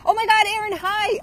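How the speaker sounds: high, loud delighted squeal of hello; thrilled, high-pitched shout of recognition; excited greeting. Real, not acted. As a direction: burst out greeting a friend who just appeared. Spots Erin joining the live stream.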